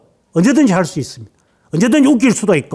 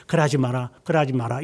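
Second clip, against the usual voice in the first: gentle mock grandparent; 5 semitones lower, 8 dB quieter than usual. Soft grandparent voiced.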